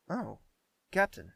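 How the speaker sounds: jolted out of a daydream, sudden high and loud; startled prince suddenly notices the captain. Acted. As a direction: caught off guard, the name bursts out.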